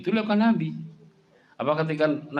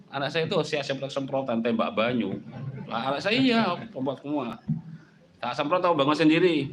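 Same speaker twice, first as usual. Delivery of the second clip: usual speaking voice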